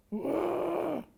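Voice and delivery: sudden high whoop of delight; cry of joy. Retold or imitated. Hearing he got the role, a high joyful whoop. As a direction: let out a joyful yelp at the good news. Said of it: "J'ai poussé un cri de joie".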